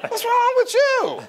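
very high, loud, drawn-out exclamation; comic outrage. Retold or imitated. Telling off the would-be banker in his story, comic outrage. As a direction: wail it in hammed-up disbelief.

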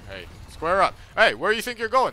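loud, high, playful shout; mock fight challenge. Streamer yelling a challenge at a game robot, loud and high. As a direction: call the robot out to fight, loudly.

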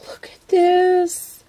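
real happy surprise; delighted gasp. Real, not acted. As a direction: gasp and point it out. Gasps at a new item.

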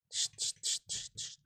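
voiceless hushing hiss; whispered shushing. Real, not acted. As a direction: hush it quietly, under breath. Hushing a nearby monster.

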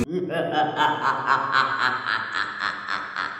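low, soft villain laugh; evil cackle. Pretend. After naming the terror path. (risa malvada grave)

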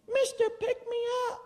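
high squeaky frog voice; tiny high frog pleading; talking frog. Acted.

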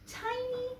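very high, soft, slow TINY. A narrator's reading. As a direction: squeeze TINY up small and high. Mimics smallness of the dragon.

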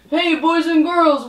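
ordinary talk

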